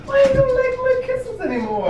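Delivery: high, whiny mock pout; mock hurt whine. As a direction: pout it, playfully wounded. Mock hurt, much higher.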